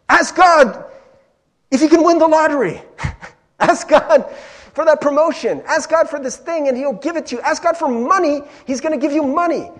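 loud, high mock preacher shouting big promises; hype prosperity preacher. Mocking preachers who promise money and lottery wins, hyped-up shouting. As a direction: imitate a preacher hyping the crowd at full pitch. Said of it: "there's some preachers that'll be like".

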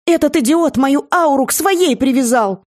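high-pitched angry exclamation; indignant complaint. A narrator's reading. Seer accusing the shapeshifter, high and indignant.

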